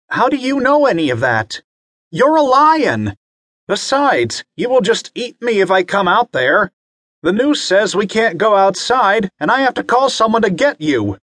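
put-on a scared kid rattling it off; frightened boy. Bobby panicking at the lion outside, high child voice. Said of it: "Bobby gasped".

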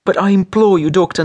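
high, despairing plea; moaning in despair. A narrator's reading. Wife imploring the reluctant doctor, moaning in despair. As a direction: implore him with a moan. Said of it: "Nelly moaned in despair".

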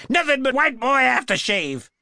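high, jeering cry; screamed. A narrator's reading. Maced woman moving in on the cop, high scream. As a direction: yell it high and wild. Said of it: "she screamed, moving in".

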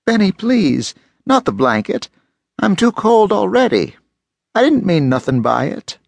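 high, pleading, frightened woman; whining plea. Acted. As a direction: whimper it, begging not to lose it. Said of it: "heard her whine".

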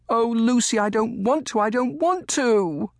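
put-on very high, distressed child's wail; choked-up plea. A narrator's reading. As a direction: plead as a boy close to tears. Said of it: "Colly's voice caught in his throat".

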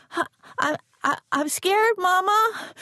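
stammering, rising up high in fear. A narrator's reading. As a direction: let the voice climb as panic grows. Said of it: "My voice climbing higher".

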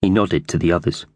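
plain reading voice

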